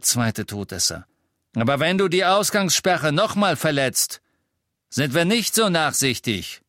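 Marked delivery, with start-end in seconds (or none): 1.5-6.6 raised, high threatening voice; stern warning. A narrator's reading. Second Death Eater warning about the curfew, stern warning. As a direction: deliver a loud final warning.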